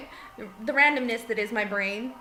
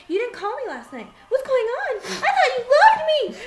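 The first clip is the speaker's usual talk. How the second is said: high, hurt mock complaint; mock jilted lover; 12 semitones higher, 8 dB louder than usual. Crashing a couple's fight, a high mock jilted complaint. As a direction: play the wounded ex to stir trouble. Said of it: "I walk up and I was like".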